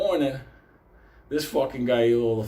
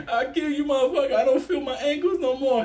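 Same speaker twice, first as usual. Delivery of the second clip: high-pitched, crazed mock rage; cracked; 13 semitones higher than usual. Friend lunging at assassin, high hysterical threat. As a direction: rant very high, as if snapped. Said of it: "He cracked".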